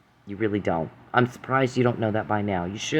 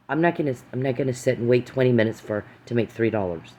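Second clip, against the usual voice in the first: usual speaking voice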